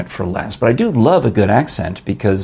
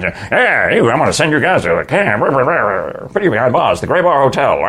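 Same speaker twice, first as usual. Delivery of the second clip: put-on old-time gangbuster voice, higher and swooping; hammy vintage G-man patter; J. Edgar Hoover; 4 semitones higher than usual. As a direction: play a nineteen-forties lawman threatening crooks. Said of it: "think about J. Edgar Hoover".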